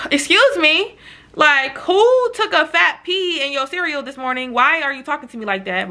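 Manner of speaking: high, taken-aback "excuse me"; offended disbelief. Retold or imitated. Answering a friend's attitude, affronted and incredulous.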